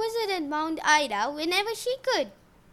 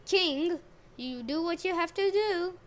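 plain reading voice

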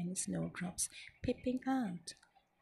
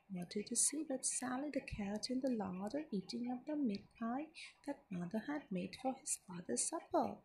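ordinary delivery

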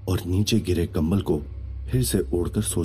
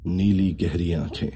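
usual speaking voice

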